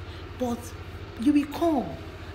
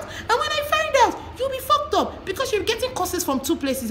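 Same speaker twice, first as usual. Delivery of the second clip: high threat. Acted. Threatening cheating boyfriend.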